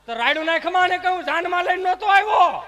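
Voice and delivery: acted full-force furious retort; quarrelling husband. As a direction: shout the wife down. Husband shouts back in quarrel, acted.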